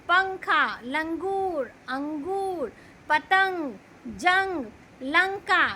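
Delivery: a teacher demonstrating the nasal sound word by word; nasal anusvara sound. Shown as a demonstration. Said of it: "हम बोलते हुए नाक से उच्चारण".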